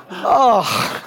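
ordinary delivery

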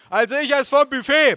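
very high, loud holler; bellowing aunt. A narrator's reading. Aunt chewing at table, bellowing. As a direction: holler it over everyone. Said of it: "brüllte meine Tante kauend".

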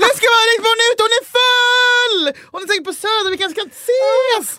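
high, loud, breathless tipsy gushing; hammed-up manic high babble; frantic excitement. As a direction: gush wildly at the party crowd. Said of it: "Jag bara".

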